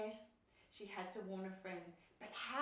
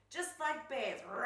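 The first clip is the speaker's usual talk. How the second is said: loud, high holler into a roar; boisterous bear game; playful roaring shout; 5 semitones higher, 10 dB louder than usual. A narrator's reading. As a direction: yell it and roar like a pretend bear. Friends shouting and roaring as bears.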